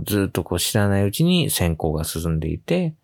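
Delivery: usual speaking voice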